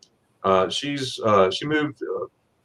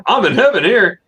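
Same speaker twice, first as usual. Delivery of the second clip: high, louder gushing delight; retold bliss; 9 semitones higher, 5 dB louder than usual. Customer watching spaghetti ice being made, retold delight. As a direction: gush as if reliving a treat.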